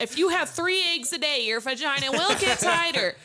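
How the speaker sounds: high, loud, emphatic announcement; belted-out comic health claim; loud proclamation. Real, not acted. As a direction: declare it over the laughter. Mock proclamation over others' laughter.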